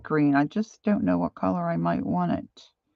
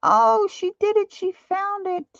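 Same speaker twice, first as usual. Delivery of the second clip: delighted squeal; 4 dB louder than usual. Real, not acted. Friend in chat manages it.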